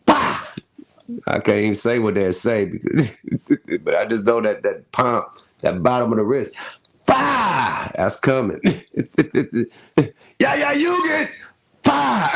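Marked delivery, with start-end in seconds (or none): 0.1-0.5 a sudden, high "bah!" like a palm smack; imitated slap sound. Mimicking the Letter Gang's strike, high slap noise. 7.1-7.9 a sudden, high "bah!" like a palm smack; imitated slap sound. Mimicking the Letter Gang's strike, high slap noise. 11.8-12.3 a sudden, high "bah!" like a palm smack; imitated slap sound. Mimicking the Letter Gang's strike, high slap noise.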